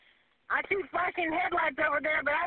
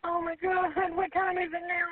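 ordinary delivery

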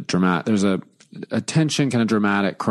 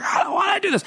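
put-on whiny child shout; faster than usual. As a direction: wail it like a dramatic kid. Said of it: "she flung herself on the bed".

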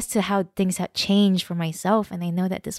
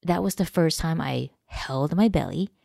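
ordinary talk

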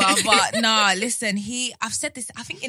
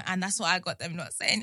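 ordinary talk, nothing marked

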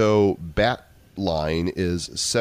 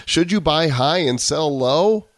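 mock host; 8 semitones higher, 5 dB louder than usual. Mock advice-show host question.

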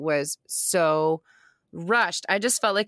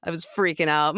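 usual speaking voice

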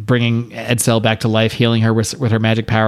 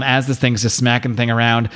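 ordinary delivery

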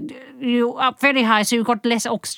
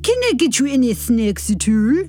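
put-on Swiss accent. Swiss waitress voiced again.

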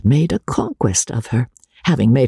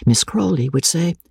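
plain reading voice

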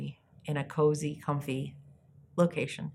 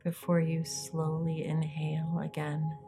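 soft spa whisper; 7 dB quieter than usual. Acted. Leads a guided meditation.

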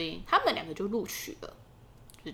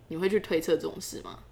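usual speaking voice

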